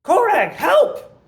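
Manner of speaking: high, loud, slow desperate yell; shout for help. Acted. Illusion cries for the companion, desperate loud shout. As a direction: scream his name across the room for help. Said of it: "And then I'm going to shout".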